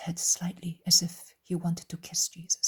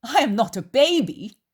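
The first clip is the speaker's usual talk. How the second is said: loud, high scoff; loud, scornful retort; 6 semitones higher, 9 dB louder than usual. A narrator's reading. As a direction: scoff with a swooping pitch. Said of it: "says Judas scoffingly".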